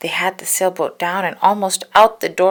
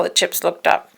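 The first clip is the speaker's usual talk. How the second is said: ordinary delivery, nothing marked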